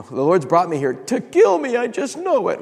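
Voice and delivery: high, rising panicked voice; hammed-up panicked certainty; mock panic. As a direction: voice climbing high in comic dread. Acts out his panicked thought that night.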